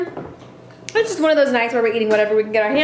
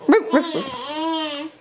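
slow playful barking puppy; puppy barks; 3 semitones higher, much slower than usual. Pretend. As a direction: bark slowly like a toy dog. Voicing puppy dog for child.

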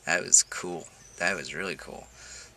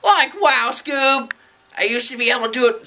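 much higher, loud cartoon stoner voice; Shaggy impression; 7 dB louder than usual. Vlogger doing a cartoon voice for the camera, high and goofy. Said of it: "my Shaggy impression".

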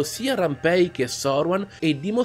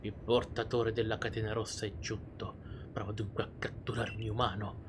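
whispered voice of a deity; telepathic voice; 4 semitones lower, 8 dB quieter than usual. Acted. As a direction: breathe it like a voice in the head. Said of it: "Una voce nella mia testa?".

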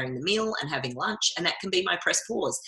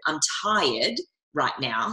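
firm, slow refusal; slower than usual. Retold or imitated. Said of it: "And I went".

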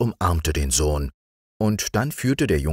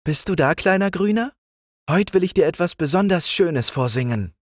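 much higher, sweet princess nightingale; sweet little voice; 14 semitones higher than usual. A narrator's reading. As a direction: call down sweetly from the branches. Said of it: "ein liebliches Stimmchen rief".

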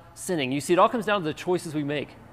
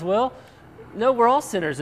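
lightly voiced mother, higher and louder; quoted mom explaining; 7 semitones higher, 5 dB louder than usual. Preacher quoting mom answering the daughter, lightly imitated correction. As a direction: imitate a mom setting a child straight. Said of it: "And, and Rhonda goes".

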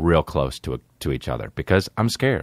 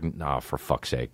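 muttered aside; 7 semitones lower, 9 dB quieter, slower than usual. Retold or imitated. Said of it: "inner voice giving up".

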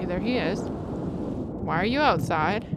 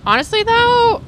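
excited squeal; 13 semitones higher, 9 dB louder than usual. Real, not acted. Excited reaction.

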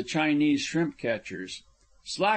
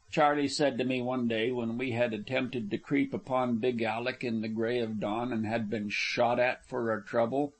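ordinary delivery

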